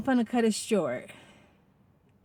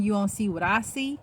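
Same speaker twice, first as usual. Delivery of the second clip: ordinary talk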